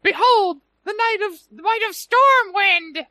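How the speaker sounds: very high squeaky tiny-guy voice; high-pitched voice. Retold or imitated. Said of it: "this one already has a high-pitched voice".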